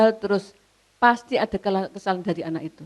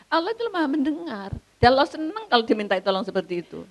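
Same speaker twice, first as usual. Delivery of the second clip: ordinary talk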